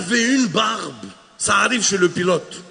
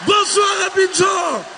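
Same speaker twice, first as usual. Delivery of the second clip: higher, louder, slow Bonsoir, Abidjan; shouted greeting; 9 semitones higher, 3 dB louder, slower than usual. Acted. Comedian redoing his own welcome, higher and louder. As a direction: stretch "Bonsoir, Abidjan" out loud. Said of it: "aller moi-même me présenter".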